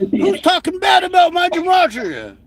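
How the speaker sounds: high squeaky caricature; hammed-up indignant voice; high mock impression. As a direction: whine it like an offended crank. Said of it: "He's like".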